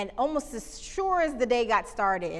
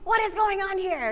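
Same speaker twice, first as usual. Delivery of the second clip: much higher, louder angry demand; 11 semitones higher, 5 dB louder than usual. Retold or imitated. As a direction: bark it like an offended customer. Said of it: "He's so mad".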